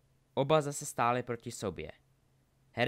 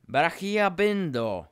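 high, loud, drawn-out incantation; roared spell; 6 semitones higher, 10 dB louder, much slower than usual. A narrator's reading. Harry binds Draco in the duel, roaring. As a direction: roar the binding spell slowly. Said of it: "zařval Harry".

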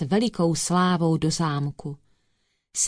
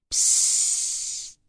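sharp voiceless psst; whispered hush; 3 dB quieter than usual. A narrator's reading. Cat hushing the dog at night, whispered. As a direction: hush someone with a long hiss of breath. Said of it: "zašeptal známý hlas".

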